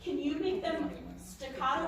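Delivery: ordinary talk, nothing marked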